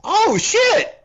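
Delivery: high-pitched exclamation; squeaky, high exclamation-point reaction; excited alarm. Acted. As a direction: react as if thrilled and found out. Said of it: "I'd be like".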